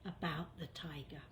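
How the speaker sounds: put-on child's whisper. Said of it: "she says".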